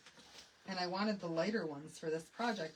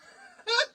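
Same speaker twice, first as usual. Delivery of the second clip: very high, loud giggle; high gleeful laugh; squeaky laugh; 14 semitones higher, 8 dB louder than usual. Real, not acted. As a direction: squeal with glee. Ending laugh.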